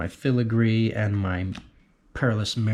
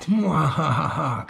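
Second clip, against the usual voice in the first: slow, high villain laugh; a mad inventor's triumphant mwahaha; evil cackle; 5 semitones higher, slower than usual. Pretend. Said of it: "Our weaponsmith".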